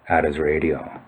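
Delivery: ordinary talk, nothing marked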